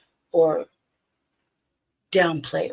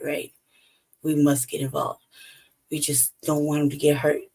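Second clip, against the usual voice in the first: normal talking voice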